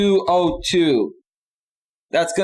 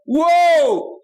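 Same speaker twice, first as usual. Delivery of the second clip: excited shout; 9 semitones higher than usual. Real, not acted. Streamer shouting thanks at a viewer for her big gift. Reacts to a donation.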